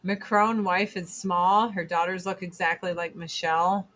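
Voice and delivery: usual speaking voice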